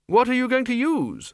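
high-pitched, pressing query; Archchancellor's question. A narrator's reading. Ridcully questions the Dean.

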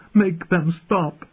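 high-pitched, drawn-out plea; anguished, high wail of words; desperate cry. A narrator's reading. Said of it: "she cried".

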